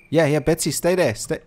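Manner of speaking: loud, high command; calling out. Real, not acted. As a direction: order the cow to stay put. Commanding animal to stay.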